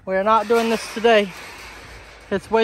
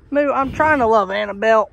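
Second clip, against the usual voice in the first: ordinary delivery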